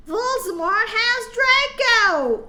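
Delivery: slow, high, loud shout. Acted. Students shouting the news to Dumbledore, slow high shout. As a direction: blurt it out high and loud together. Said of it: "we shouted at the same time".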